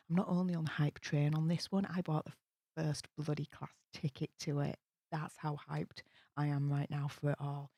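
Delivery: usual speaking voice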